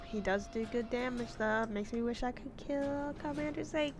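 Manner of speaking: ordinary talk, nothing marked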